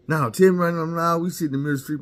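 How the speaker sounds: high, loud insistent demand; wife demanding the truth in the street, loud. Retold or imitated. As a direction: demand the truth right there. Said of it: "wife demands".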